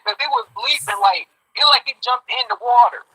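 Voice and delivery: ordinary talk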